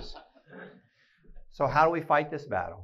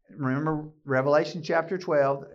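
ordinary delivery

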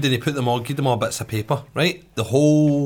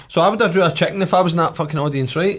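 loud, fast and worked up; getting louder, more worked up; excited; 6 semitones higher, 4 dB louder than usual. Real, not acted. Excited rant about the chicken.

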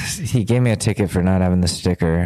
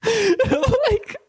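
a much higher voice breaking between laugh and sob; laugh-crying. Real, not acted. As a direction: laugh through tears at the bad timing.

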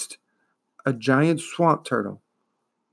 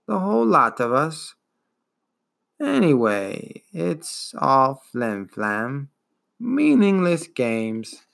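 put-on slow, wheezing, world-weary old beast; weary ancient turtle; slower than usual. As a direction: let every word sag with ancient boredom. Turtle speaks again.

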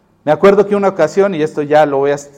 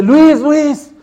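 high, loud desperate calling of a name; calling out in panic; 10 semitones higher, 7 dB louder than usual. Retold or imitated. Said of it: "me habla muy desesperado". (amigo llamando desesperado a Luis, grito alto y angustiado)

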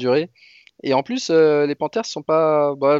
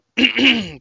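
dry cough to clear the throat; 9 semitones higher, 5 dB louder than usual. Real, not acted. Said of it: "J'ai un chat dans la gorge".

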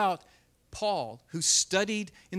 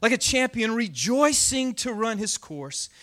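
dramatic scripture reading; 4 dB louder than usual. Acted. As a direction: declaim the psalm to the room. Reads psalm aloud with force.

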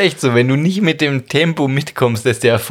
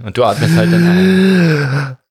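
strained inhale; 6 semitones higher, 4 dB louder, slower than usual. Retold or imitated. Acts the panicked breathing listener.

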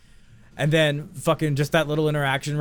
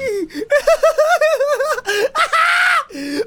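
loud, high shrieking laugh; long, uncontrolled real cackle; helpless laughter; 6 dB louder than usual. Real, not acted. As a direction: laugh until it squeals. Cracks up at his own slip.

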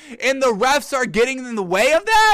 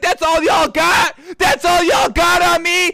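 loud, high taunting yell; chest-out taunt at the defense; taunting player; 6 semitones higher, 5 dB louder than usual. Retold or imitated. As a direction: shout it in the defenders' faces. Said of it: "flex and be like".

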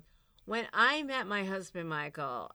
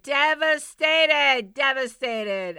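hammed-up devastated; 6 semitones higher, 10 dB louder, slower than usual. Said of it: "I was devastated".

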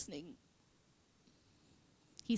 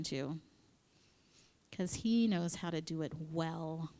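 ordinary talk, nothing marked

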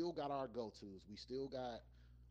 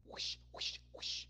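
air quotes whoosh sound effect; slower than usual. Shown as a demonstration. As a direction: breathe out a quick whoosh for each air quote. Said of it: "that's my sound effect for 'em".

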